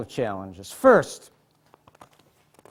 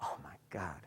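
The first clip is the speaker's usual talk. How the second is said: overcome; 11 semitones lower, 17 dB quieter than usual. Real, not acted. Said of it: "I feel so emotional".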